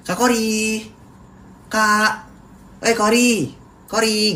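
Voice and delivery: high, friendly calling of a name; polite greeting call. Retold or imitated. A polite stranger greeting Kori, high friendly call. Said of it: "Kalau manggilnya kayak gini".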